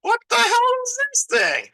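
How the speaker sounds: a hammed-up panicked gasp at the monster; mock shocked townsfolk. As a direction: squeal it like a frightened villager. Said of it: "They're like".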